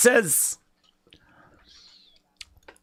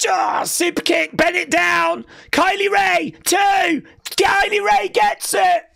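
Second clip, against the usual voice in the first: excited shout; 13 semitones higher than usual. Real, not acted. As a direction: call the pin like it's huge. Match-winning finish.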